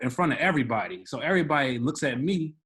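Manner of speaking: usual speaking voice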